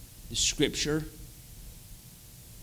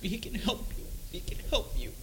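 hushed, tender repeat; soft reassurance; 4 semitones higher, 14 dB quieter than usual. Retold or imitated. Wife urging her husband toward God, hushed and reassuring. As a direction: comfort very softly, insisting gently.

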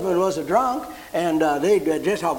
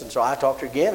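normal talking voice

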